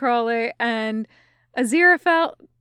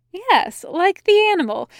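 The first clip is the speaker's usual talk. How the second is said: high, louder, emphatic yes; upbeat and emphatic; eager confirmation; 8 semitones higher, 5 dB louder than usual. A narrator's reading. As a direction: confirm it brightly, pitch well up. Angel confirms brightly.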